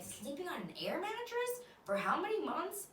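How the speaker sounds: high, mock-shocked voice; incredulous friend. Mocking people amazed at the mattress, high disbelief. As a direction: squeak it out in disbelief. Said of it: "those situations where you're, like".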